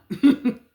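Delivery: brief loud chuckle; a quick burst of laughter; amused laugh. Real, not acted. Reader laughing at the idea of running from this, amused chuckle.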